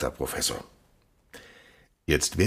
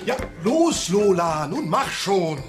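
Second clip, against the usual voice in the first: drängend rufen; 4 dB louder, much slower than usual. Acted. Drängt Lola auf die Bühne.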